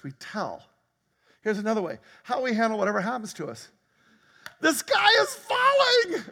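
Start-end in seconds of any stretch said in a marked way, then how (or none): 4.6-6.2 high, slow shriek of alarm; hammed-up terror, much louder; mock panic. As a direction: squeal it like Chicken Little.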